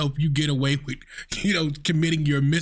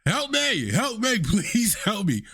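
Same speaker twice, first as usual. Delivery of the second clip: lightly voiced panicked plea; mock cry for help; 8 semitones higher, 6 dB quieter, slower than usual. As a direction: imitate an arrested driver's whiny plea for help. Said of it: "you start screaming out in public".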